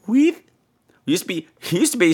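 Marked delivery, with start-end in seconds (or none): 0.1-0.4 imitated tiny, high-pitched voice; high little chirp, rising each time; teeny tiny high voice. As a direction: mimic a tiny woman serving food. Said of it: "little teeny tiny high voice".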